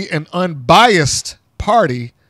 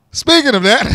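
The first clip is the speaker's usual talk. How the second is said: laughing; 8 semitones higher than usual. Real, not acted.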